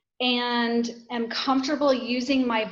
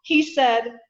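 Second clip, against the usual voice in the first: ordinary delivery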